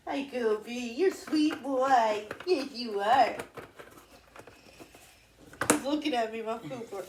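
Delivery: soft doting voice for the dog; sweet talk to the family dog; pet talk. Real, not acted. Talking fondly to the dog.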